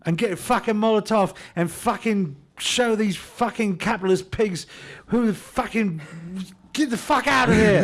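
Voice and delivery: furious high yell at the pigs; angry revolutionary shout. Acted. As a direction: scream it like a rioter. Riot character yelling at capitalists.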